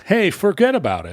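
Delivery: high "Hey, forget about it"; New York accent. Retold or imitated. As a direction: toss it off like a New Yorker. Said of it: "start saying".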